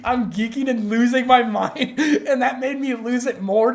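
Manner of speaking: laughing through the words. Real, not acted. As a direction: laugh while telling it. Laughing while retelling.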